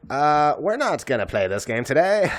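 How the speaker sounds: joke-voice your refusal; refusing to go upstairs, comic put-on voice; mock put-on refusal. Retold refusing spooky upstairs.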